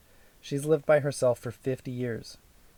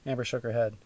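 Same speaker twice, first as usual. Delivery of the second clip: ordinary delivery, nothing marked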